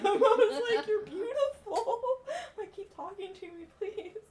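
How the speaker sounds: high, loud, giggling adoration; laughing swoon. Retold or imitated. Smitten by the cart man's accent, laughing and swooning. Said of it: "almost fell in love with him".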